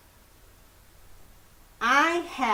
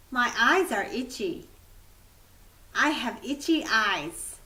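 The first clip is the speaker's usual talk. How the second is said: fast drill read; faster than usual. Shown as a demonstration. Fast repeat drill of phrases.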